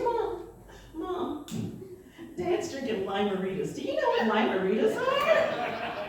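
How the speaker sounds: put-on high, eager tattling child; bursting to tell mom a secret; excited kid. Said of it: "super excited".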